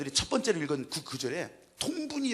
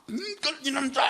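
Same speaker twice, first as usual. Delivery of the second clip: angry scolding; 5 semitones higher than usual. Retold or imitated. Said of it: "통분히 계시면서 가는 거야 이해가 되겠지만 막".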